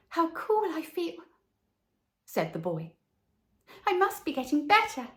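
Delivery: put-on a feverish child feeling better; sick little boy. A narrator's reading. Boy in bed feels the swallow's wings, high relieved child.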